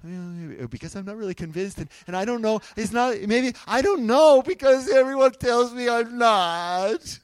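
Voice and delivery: waffling excuses building to a loud wail; mock doubting believer. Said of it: "you're like".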